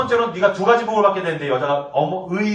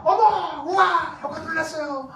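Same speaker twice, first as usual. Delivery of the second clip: high, slow gushing praise; wife's delight; 6 semitones higher, slower than usual. Retold or imitated. 설교자가 아내의 감탄을 흉내: 높게 감탄. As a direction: marvel at his cooking. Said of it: "그 여자도 똑같아".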